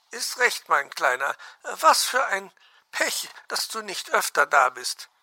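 very high chattering bird voice; fond magpie. Acted. Magpie talks to the little fox, high fond chatter. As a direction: cluck fondly at the little one.

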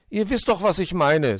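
high, loud huffy retort; slightly miffed. A narrator's reading. Borstel answers the cat's correction, a bit miffed. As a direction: brush off the correction, peeved. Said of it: "sagte der Igel ein bisschen angefressen".

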